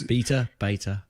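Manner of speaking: first "Beta" BEE-tuh, second "beta" BAY-tuh; two betas contrasted. Shown as a demonstration. Comparing two pronunciations of beta.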